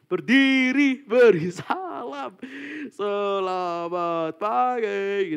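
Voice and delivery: loud, high, drawn-out classroom chant; very slow, happy rote chorus; schoolchildren's greeting, imitated. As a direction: drag out each syllable like a primary class saluting the teacher. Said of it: "Pas aku S D itu gitu".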